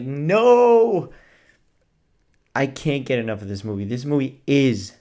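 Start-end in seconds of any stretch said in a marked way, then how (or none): 0.0-1.1 anguished no. Real, not acted. Film ends, anguished cry.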